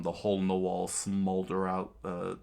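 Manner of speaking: usual speaking voice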